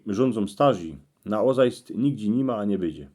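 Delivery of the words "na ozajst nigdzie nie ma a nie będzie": ordinary delivery, nothing marked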